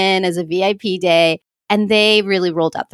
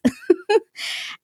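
a sudden high burst of laughter; 14 semitones higher, 4 dB quieter than usual. Real, not acted. Laughing at her own fear of heights, high squealing laugh. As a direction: let a squeal of laughter escape.

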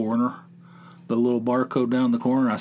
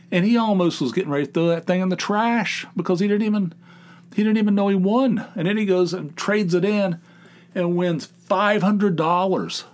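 raised pitch, incredulous complaint; exasperated rant; 7 semitones higher than usual. Real, not acted. Father griping about the boy's dumb luck, exasperated. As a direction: rattle off the injustice, then drag out the sum.

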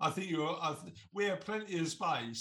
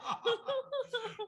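high laugh. Real, not acted. Laughs at teasing remark.

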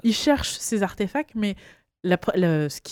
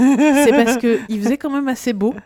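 laughing; 5 semitones higher, 7 dB louder than usual. Real, not acted. Funny memory of melting eggs.

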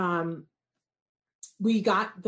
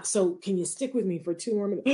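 ordinary talk, nothing marked

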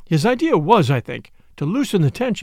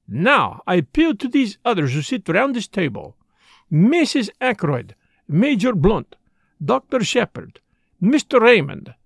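higher, measured, emphatic address; Poirot, formal appeal; 5 semitones higher than usual. Acted.

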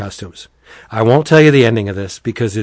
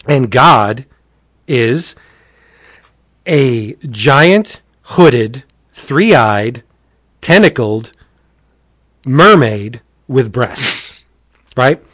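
usual speaking voice

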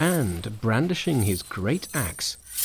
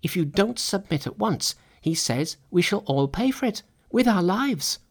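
higher-pitched frightened messenger; alarmed monsters; 6 semitones higher than usual. A narrator's reading. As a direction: pass on the threat in a high, worried voice. Monsters report the threat to Monkey.

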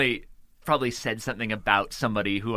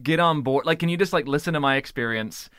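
exasperated mock outburst, pitched up and louder; retold snapping; 4 semitones higher, 5 dB louder than usual. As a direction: imitate a partner losing patience. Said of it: "you kind of snapped at me".

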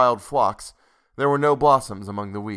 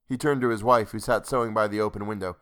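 plain reading voice

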